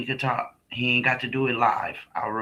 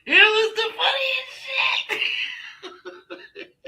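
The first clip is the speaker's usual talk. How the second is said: high squeal fading into wheezing laughter; cracking up mid-sentence; helpless laughter. Real, not acted. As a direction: squeeze the words out through laughing. Cracking up at her memory.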